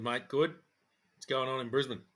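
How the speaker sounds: normal talking voice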